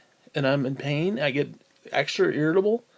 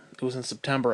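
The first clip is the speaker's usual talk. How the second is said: ordinary delivery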